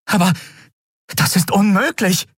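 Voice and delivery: high-pitched disbelief; exclaiming. A narrator's reading. Endriel hears of the Sapphire Star, exclaiming in disbelief. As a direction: cry out in protest. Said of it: "rief sie aus".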